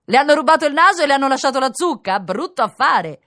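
loud, high jeering call; mocking onlookers. A narrator's reading. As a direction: heckle him from the street, slowing at the end. Laughing onlookers mock the man. (sfottò gridato dalla folla)

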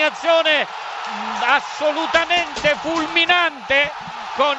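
shouting the goal live, high and loud; excited goal commentary. Real, not acted. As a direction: burst into a full-voice goal call.

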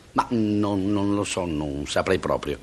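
low, hesitant mumble of a stumped student; halting, subdued answer; unsure student. Acted. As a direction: quietly admit defeat, dragging the end. Student stumped in oral exam.

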